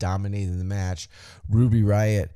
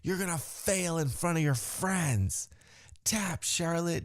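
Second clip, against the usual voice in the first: higher, slow, softer taunt; lightly voiced sneering heel; 7 semitones higher, 4 dB quieter than usual. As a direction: gloat over her rival. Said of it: "in-ring character work".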